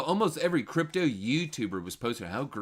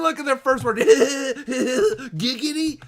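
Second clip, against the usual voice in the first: high, loud laugh into a drawn-out giggity; 13 semitones higher, 10 dB louder, much slower than usual. Real, not acted. Streamer cracks up reading the post's first word, laughing loudly. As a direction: cackle and stretch the word slowly.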